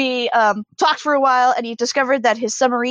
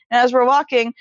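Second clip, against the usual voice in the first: normal talking voice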